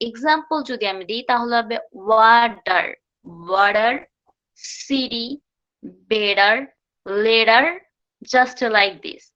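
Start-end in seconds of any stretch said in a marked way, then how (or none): 2.1-7.6 ওয়াটার, সিটি, বেটার, লেটার with T as D; word by word, tap the T in বেটার like D; flap T. Shown as a demonstration. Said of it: "sounds like D".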